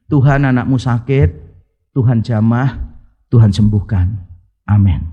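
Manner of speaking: low, plain, brief prayer with pauses; simple pastor's prayer. Acted. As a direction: pray in short low phrases, sinking to the Amen. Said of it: "Pendeta pertama itu doanya begini".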